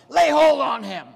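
a king's loud, angry command to seize the man; angry order. Retold or imitated. Jeroboam ordering his men to seize the prophet, angry loud command. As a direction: bark it like an offended king giving orders. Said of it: "and his response was".

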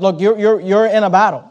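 ordinary delivery

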